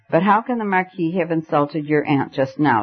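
ordinary delivery

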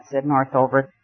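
plain reading voice

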